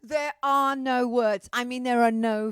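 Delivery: loud theatrical despair; exasperated outburst. Acted. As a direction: throw up your hands and bellow. Dramatic exasperated opening rant.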